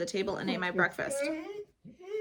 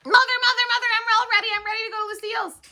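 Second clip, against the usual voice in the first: eager calling; 10 dB louder, faster than usual. Acted. Child calling her mother eagerly.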